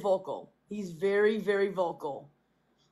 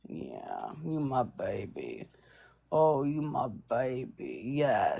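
much lower, slow, cooing to a pet; deep cooing voice; 8 semitones lower, slower than usual. Acted. Owner cooing to her clingy cat, deep slow coo. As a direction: drop way down and coo slowly.

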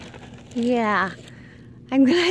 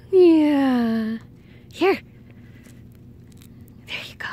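usual speaking voice

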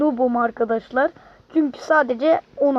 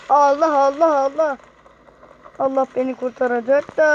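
normal talking voice

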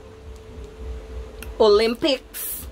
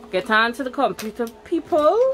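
ordinary delivery

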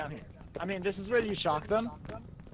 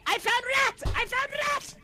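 excited high shout; 3 dB louder than usual. Real, not acted. Calling teammates across the map.

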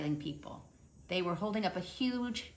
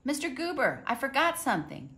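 higher, louder call after him down the street; 10 semitones higher, 4 dB louder than usual. Acted. Mister Hatch calling the postman back from the porch, raised and higher. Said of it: "Mister Hatch called from the porch".

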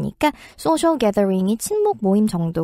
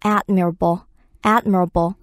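admirable stressed on the first syllable; much slower than usual. Shown as a demonstration. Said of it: "일음절로 강세가 변화합니다".

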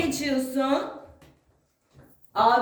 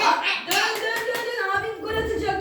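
excited; 8 semitones higher than usual. Real, not acted. Cheering kids' shots.

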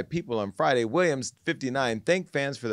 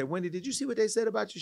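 higher, fast, eager question; eager gossip; 9 semitones higher, 3 dB quieter, faster than usual. Retold or imitated. Host retelling calling Wendy about gossip, higher and fast. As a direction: rush it out like breaking news. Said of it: "I would call Wendy and say".

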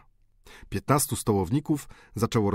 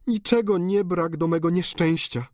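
high, tearful pleading daughter; pleading countess; 9 semitones higher, 3 dB louder than usual. A narrator's reading. Daughter begging her dying father, high and tearful. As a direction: beg the dying father for forgiveness. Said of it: "countess laments to Eugene".

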